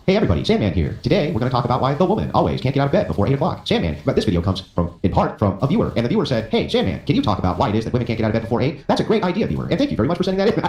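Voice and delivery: high, sing-song video-intro cadence, pitch leaping about; Sandman. Acted. Guest mimicking Sandman opening a viewer-request video, exaggerated impression. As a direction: imitate a vlogger's peculiar, over-bright presenter delivery. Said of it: "can I give my Sandman impression".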